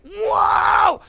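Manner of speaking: high, loud shouted whoa; mock shout. Bandmate panicking on stage, a loud high mock shout. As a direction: yell it like you're freaking out. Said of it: "It's like".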